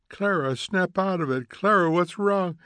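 alarmed. Acted. Mildred pleading with sobbing friend.

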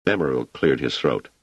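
plain reading voice

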